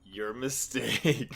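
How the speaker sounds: low, very drawn-out comeback; cold retort. Retold or imitated. Barry answering Wally's plea, slow low mock menace. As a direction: stretch it out slowly, low and soft.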